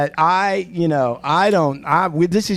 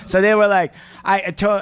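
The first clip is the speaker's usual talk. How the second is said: ordinary talk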